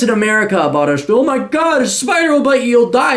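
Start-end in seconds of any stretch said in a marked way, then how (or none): 1.2-3.2 much higher, mock alarm; mock hysterical American. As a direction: squeal it like a scared know-nothing. Mocking ignorant Americans' fear.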